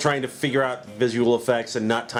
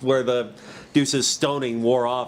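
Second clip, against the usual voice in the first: ordinary delivery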